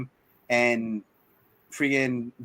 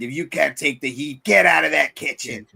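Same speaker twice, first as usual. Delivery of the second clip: villain voice; 3 dB louder than usual. Retold or imitated. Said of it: "He's like".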